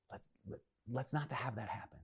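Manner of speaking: very soft, confiding voice; hushed plea. Retold or imitated. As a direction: voice a friend quietly talking someone out of it. Peter gently pleading with his friend.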